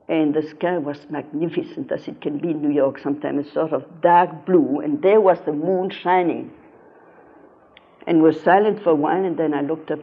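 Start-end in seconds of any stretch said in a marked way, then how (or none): none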